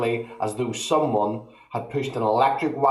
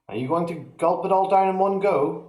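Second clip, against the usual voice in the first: higher, lightly voiced boy asking; curious boy; 7 semitones higher than usual. A narrator's reading. George teasing grandma with a higher boyish question. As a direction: ask like a cheeky young lad.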